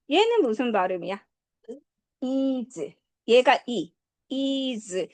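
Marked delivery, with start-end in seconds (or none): 2.2-2.9 "is" ending in a buzzed z; voiced z ending. Shown as a demonstration. Teacher showing how "is" ends, buzzing z. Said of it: "즈 발음은 어떻게 된다 그랬어?". 4.3-5.1 "is" ending in a buzzed z; voiced z ending. Shown as a demonstration. Teacher showing how "is" ends, buzzing z. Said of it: "즈 발음은 어떻게 된다 그랬어?".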